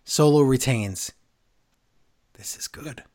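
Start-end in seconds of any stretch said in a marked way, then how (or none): 2.3-3.0 whispered aside. Real, not acted. Quiet aside.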